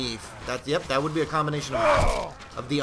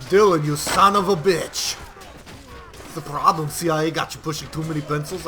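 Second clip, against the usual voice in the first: put-on a cheesy Arnold action-hero accent; Arnold impression. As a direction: ham up a bad Arnold movie line. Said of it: "terrible Arnold impersonation".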